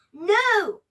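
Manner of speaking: loud, high cry of No!; frightened cry. Acted. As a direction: cry out in terror. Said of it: "cried the witch".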